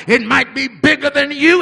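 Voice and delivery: ordinary delivery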